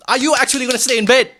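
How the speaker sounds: high, loud scolding; yelling at himself in disbelief; loud disbelieving shout. Real, not acted. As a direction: shout the question at yourself.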